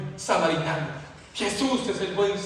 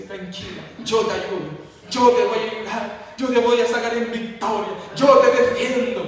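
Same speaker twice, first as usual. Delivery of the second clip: rising, louder preacher's build; impassioned preaching, pushing higher; preaching at full force; 4 semitones higher, 5 dB louder than usual. Acted. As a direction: build the appeal, climbing and loud. Preaching climax.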